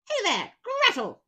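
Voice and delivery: high, loud call; witch calling out. Acted. Impatient witch summoning Gretel, high loud call. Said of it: "she called out to the little girl".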